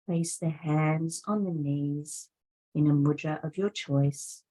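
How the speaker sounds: usual speaking voice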